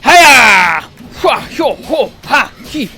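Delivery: loud karate-style battle cries; silly karate yells. Real, not acted. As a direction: yell with each axe swing. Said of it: "It was the silly noises".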